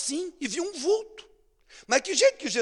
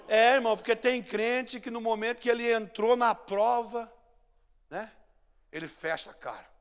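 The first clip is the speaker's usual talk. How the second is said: ordinary talk, nothing marked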